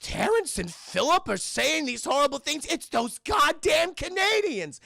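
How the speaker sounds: high, loud outraged mother; Missus Boflosky. Retold or imitated. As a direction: build to a slow mock-outraged shout. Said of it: "she is very upset".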